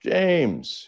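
high, drawn-out genteel greeting; effusive mock welcome; Southern hospitality. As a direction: imitate a gushing Southern host. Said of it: "with that Southern hospitality".

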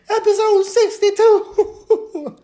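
high cartoon squeal with laughter; squeaky laughing voice. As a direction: stretch out a cartoon squeal while cracking up.

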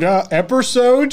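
put-on American accent. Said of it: "That's my American accent at the moment".

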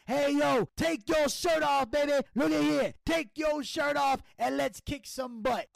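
loud hammed-up wrestler yell; mock promo shout. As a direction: bark it at the crowd. Impersonating Patterson yelling.

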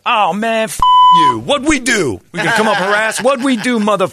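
mock yelling guys. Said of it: "First thing you hear".

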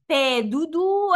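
slow mocking Fei du du, wrong tones; imitating a mispronunciation. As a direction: mimic a learner mangling Fei du du. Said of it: "and he went".

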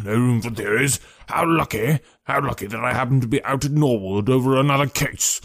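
husky, self-important inspector, brisk and hearty; pompous detective taking charge; blustering, wheezy police detective. Acted. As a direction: bluster through it in a thick, wheezy voice.

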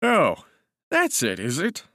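high, lilting "oh" of humouring interest; flattering. A narrator's reading. Cousin humouring the narrator, flattering. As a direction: humour him with a flattering lilt. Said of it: "in that flattering voice".

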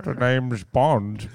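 slow Scottish Bond line; Sean Connery. Pretend. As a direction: deliver it like old Bond. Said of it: "Every old man voice I do is the same".

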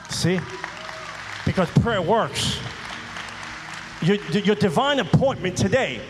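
preacher hammering a refrain; preaching. Acted. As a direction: drive the point home to the congregation. Preaching at full force.

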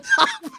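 very high burst of laughter; short helpless high laugh; real giggle. Real, not acted. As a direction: crack up mid-story. Cracking up at the guard's reply.

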